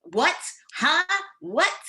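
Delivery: short, high questioning bursts; frustrated. Acted. Listener who cannot hear the speaker, frustrated. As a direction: act out a listener who keeps missing words. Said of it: "that might be frustrating for some people".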